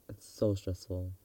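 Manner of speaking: ordinary talk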